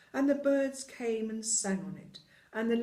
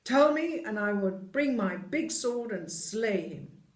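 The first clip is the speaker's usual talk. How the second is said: furious giant; 3 semitones higher, 6 dB louder than usual. Acted. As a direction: bellow the vow of vengeance. Giant vows revenge.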